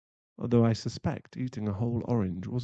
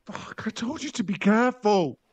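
high, loud furious shout; hurt and furious; angry yell; 11 semitones higher, 7 dB louder than usual. Acted. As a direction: snap at her for the accident. Yelling at partner after injury.

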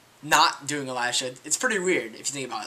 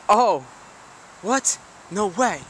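surprised; 5 semitones higher, slower than usual. Real, not acted. Discovers the pool is gone.

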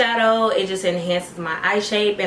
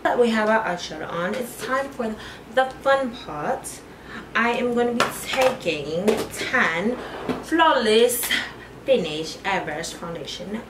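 put-on playful British accent; 3 semitones higher than usual. Vlogger playfully narrating her foundation step, mock British accent. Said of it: "I'm obsessed with, like, a British accent".

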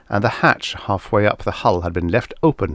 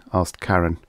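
plain reading voice

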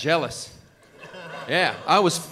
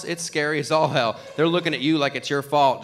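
usual speaking voice

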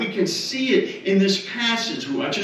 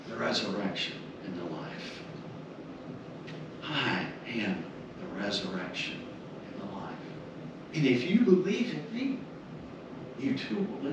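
much softer, lower and intimate; hushed quote; 5 semitones lower, 10 dB quieter than usual. Acted. Preacher quoting Jesus to grieving Martha, soft and low. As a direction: speak the promise softly, as if to one grieving friend.

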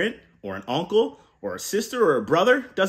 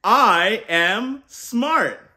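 slow, loud and higher; rousing call to repeat; 5 semitones higher, 4 dB louder, much slower than usual. Shown as a demonstration. Uncle leading kids to repeat, loud and slow. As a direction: stretch it out so kids join in. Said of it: "say it with me".

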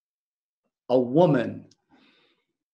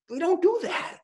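a lightly voiced, much higher prim scold; mock the leaders' prim disapproval; scandalised religious leader; 5 dB quieter than usual. Preacher mimicking the leaders' disapproval of Jesus, prim high scold.

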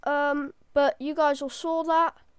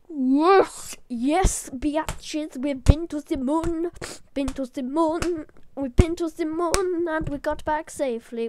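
slow, drawn-out victory chant; jubilant gloating; 3 dB louder, slower than usual. Real, not acted. Gamer celebrating a safe return from the moon, jubilant. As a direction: crow about the win, stretching the words.

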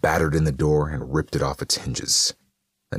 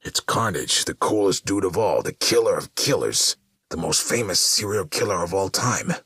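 usual speaking voice

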